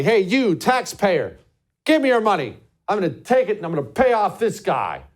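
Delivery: loud, high-pitched mock demands; hammed-up, forceful shakedown; bossy dictator. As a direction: order the taxpayer around like a king. Mimics a dictator-like president making demands.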